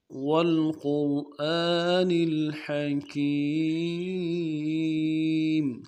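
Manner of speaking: slow melodic tajweed recitation; Quran recitation. Shown as a demonstration. A Quran teacher recites the whole lesson for students in slow recitation. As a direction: chant each verse slowly.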